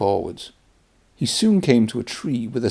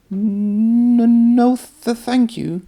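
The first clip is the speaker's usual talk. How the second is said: much higher, stammering peep; nervous stammer; 13 semitones higher, 4 dB louder, much slower than usual. Acted. Thomas refusing the haunted line, high stammering peep. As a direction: back away stuttering in a small scared voice. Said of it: "Thomas peeped".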